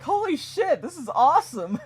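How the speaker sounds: high, loud amazed outburst; excited cheer. Retold or imitated. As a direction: cheer like an awestruck Viking. Vikings cheering at the horse.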